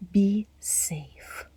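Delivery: ordinary delivery, nothing marked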